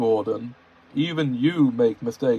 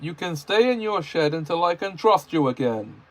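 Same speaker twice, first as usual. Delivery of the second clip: loud, higher stern telling-off; scolding; 4 semitones higher, 3 dB louder than usual. Acted. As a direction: scold the engine firmly. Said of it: "he scolded".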